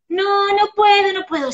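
put-on high, loud whining child's "no puedo"; whiny child. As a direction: play the child who gives up. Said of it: "child refusing". (niño quejica que no puede)

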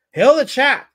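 loud, high rallying cry; hailing proclamation. Acted. Streamer reads out chat's salute, loud and proclaiming. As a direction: proclaim it to the crowd. Proclaims a chat message aloud.

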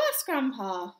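ordinary delivery

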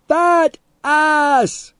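high, loud gleeful taunt; happy scream. A narrator's reading. Teddy repeats the insult to the man, screaming happily. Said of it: "Teddy screamed happily".

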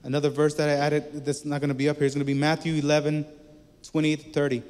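ordinary delivery

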